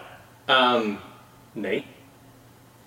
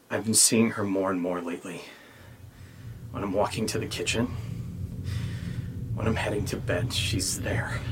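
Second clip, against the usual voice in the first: creepy reading; 5 dB quieter than usual. Shown as a demonstration. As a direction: read it like a ghost story to spook a friend. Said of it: "maybe I should read it all creepy".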